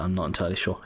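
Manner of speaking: normal talking voice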